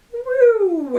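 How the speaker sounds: high loud whoop; excited cheer. Real, not acted. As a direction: whoop with glee. Cheering about shrinking pile.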